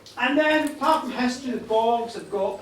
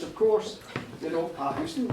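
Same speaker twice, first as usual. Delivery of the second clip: ordinary talk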